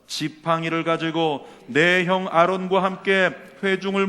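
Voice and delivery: slow, loud, measured recitation; solemn scripture reading. A narrator's reading. Preacher leading the congregation in reading scripture, solemn recitation. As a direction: proclaim the verse slowly and loudly.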